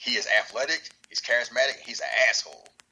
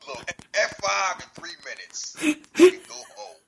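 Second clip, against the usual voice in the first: rising, gleeful and loud; brash tough-guy taunt; excited Brock voice; 9 semitones higher, 5 dB louder than usual. Retold or imitated. Brock voiced, hyped reply.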